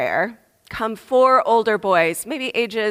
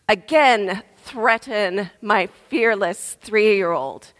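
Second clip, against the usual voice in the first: usual speaking voice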